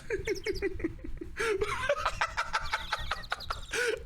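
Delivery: a high, helpless burst of laughter. Real, not acted. Laughing fit at birds.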